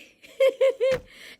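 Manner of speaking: loud high laugh at herself; self-mocking laugh; high burst of laughter. Real, not acted.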